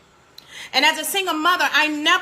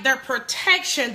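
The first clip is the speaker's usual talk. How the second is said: ordinary talk, nothing marked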